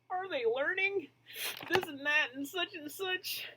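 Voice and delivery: high fretful mumbling principal; worried principal. Acted. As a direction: mumble it like a sad worried man. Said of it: "He mumbles".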